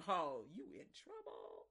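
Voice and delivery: a friend ribbing you; teasing friend. Retold or imitated. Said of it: "your friend's like".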